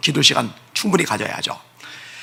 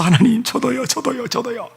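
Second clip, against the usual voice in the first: pleading high, sobbing to God; sobbing plea; 8 semitones higher than usual. Retold or imitated. Preacher reliving his tearful prayer to God, high sobbing plea. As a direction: cry out to God high-pitched, near sobbing. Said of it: "흐느끼면서".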